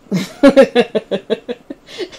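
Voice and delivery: loud high giggles; tickled laughing at oneself; real burst of laughter. Real, not acted. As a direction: crack up at your own rapping. Laughs at own rap.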